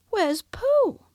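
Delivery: put-on a boy asking brightly; boyish question. A narrator's reading. Christopher Robin to Rabbit, high boyish question.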